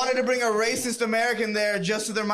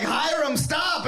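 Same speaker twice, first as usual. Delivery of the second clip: mock yell; 8 semitones higher, much slower than usual. Yelling at barber taking hair.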